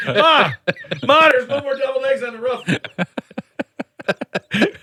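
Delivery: put-on high excited child's call to Ma; excited kid calling. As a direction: call out like a startled little kid. Child yelling to mom.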